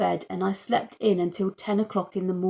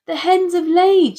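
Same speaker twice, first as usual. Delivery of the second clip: much higher, louder excited yell; 10 semitones higher, 10 dB louder than usual. A narrator's reading. Mum yells to the house from the hen house, thrilled. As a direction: call the news across. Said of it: "she yelled back to the house".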